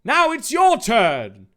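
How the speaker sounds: high, loud, drawn-out cry; hammed-up high-pitched shouting; loud dramatic dialogue. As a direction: belt it out high, like an overacted character. Acted dialogue in story reading.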